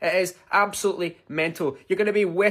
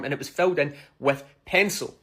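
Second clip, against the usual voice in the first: usual speaking voice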